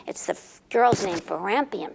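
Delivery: plain reading voice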